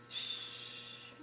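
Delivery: whispered hush, very soft; mother shushing her frightened baby. A narrator's reading. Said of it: "I whispered".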